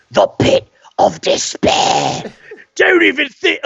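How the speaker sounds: hoarse, wheezing mock-villain rasp; strangled croak. Host acting out the pit of despair keeper, strangled croak. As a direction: croak it slowly, choking on the words.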